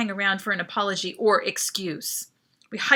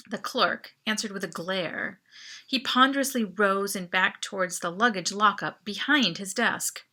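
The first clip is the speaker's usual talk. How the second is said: plain reading voice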